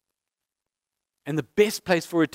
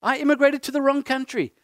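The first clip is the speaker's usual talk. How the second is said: high, plaintive why me; a pleading complaint to heaven; mock lament to God; 8 semitones higher than usual. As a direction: cry out to God in self-pity. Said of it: "I've often said to God".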